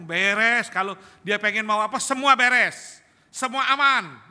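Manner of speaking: put-on loud, high, brash young man's boast; cocky youth. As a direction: shout it like a careless teenager who thinks everything is fine. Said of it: "anak muda sekarang kan ngomong". (anak muda sombong, suara keras dan tinggi)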